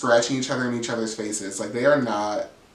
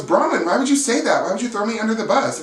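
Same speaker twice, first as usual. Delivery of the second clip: higher, fast wounded protest; hurt accusation; 9 semitones higher, faster than usual. Retold or imitated. Brittany confronting Bronwyn, higher wounded protest. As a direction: plead like the betrayed friend.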